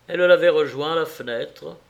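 usual speaking voice

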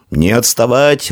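stretched narrated call; called order. A narrator's reading. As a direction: call the riders on, slowly. Rider calls out during the chase.

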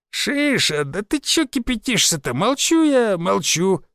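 high, mock-soothing old man's voice; calm him down like a cheeky old geezer; placating old man. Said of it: "примирительно сказал старик".

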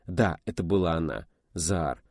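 ordinary talk

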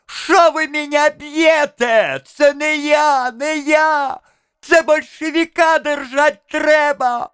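very high, slow pleading wail; piercing, drawn-out cries; thin-voiced howl. A narrator's reading. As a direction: protest in a thin howl while being beaten. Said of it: "взвыл тонкий голос".

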